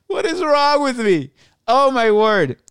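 high, exclaimed disbelief at yourself; high-pitched mock dismay after a laugh; amused self-reproach. Real, not acted. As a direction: exclaim it high, amused by your own silliness. Laughing at own odd tangent.

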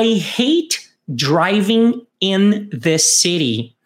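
very slow, every word laid out for learners; slow it right down so students can copy; slow model read. Shown as a demonstration. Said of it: "Vamos repetir?". (leitura bem lenta para repetir)

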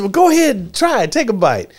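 high, quick encouraging; coaxing director. Retold or imitated. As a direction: urge the kid to try. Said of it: "he said".

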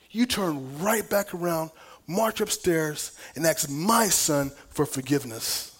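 slow, emphatic divine reprimand; God's rebuke. Retold or imitated. God rebukes a father for tearing apart His son, slow and stern. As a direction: deliver God's stern orders, weighting each word. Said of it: "And God said to me".

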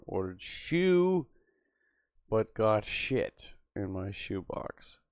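slow, drawn-out reading of a chat line; slow mock reading. Streamer reading a chat comment, slow deadpan. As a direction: read it out slowly, deadpan.

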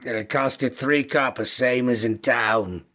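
understated Scouse newspaper seller; Scouse kobold. Acted. Kobold quotes a customer the paper's price, in Scouse. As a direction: haggle matter-of-factly in a Liverpool accent. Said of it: "very thick scouse kobold".

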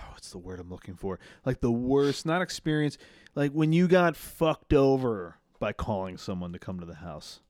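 ordinary talk, nothing marked

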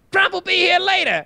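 high, loud announcement; preacher voice. Retold or imitated. Imitating the minister telling the crowd, high loud. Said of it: "he's like".

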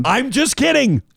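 high, slow, stretched-out insistence; hammed-up plea to offended listeners; mock exasperated protest. Hammed-up clarification to upset listeners.

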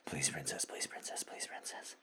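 soft breathless whisper; trembling whisper. Acted. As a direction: fade into a shaky whisper. Fading whisper after climax.

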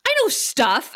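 put-on high, loud and indignant, a mouthy teenager; defensive teen. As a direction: snap back like an offended kid sister. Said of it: "And Dawn says".

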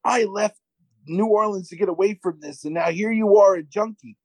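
high, indignant retold rant; mock screaming. Host acting out man's rant at his date, mock screaming. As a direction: imitate an overwrought outburst without full volume. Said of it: "So he starts screaming".

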